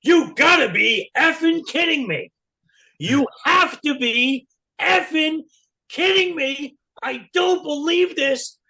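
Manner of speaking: high, loud, drawn-out mock fury; imitated exasperated shouting; hammed-up outrage. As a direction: bellow it slowly, stretching each word in disbelief. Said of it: "And Gene's like this".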